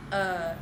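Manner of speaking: "a" as one held Northern vowel; "a" in place of the air diphthong. Shown as a demonstration. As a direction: hold a single unglided sound on "a". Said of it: "for the Northern accent, they would substitute".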